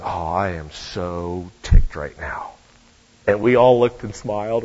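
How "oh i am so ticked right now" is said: low, slow mock huff of anger; mock angry girl. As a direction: fake being angry, lightly. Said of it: "she says".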